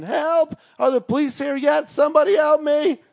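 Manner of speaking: very high-pitched imitated scream; screaming for help. Girl beating on the door, imitated screaming for help. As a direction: cry out high and desperate, acting her out. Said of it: "beating on the door and screaming".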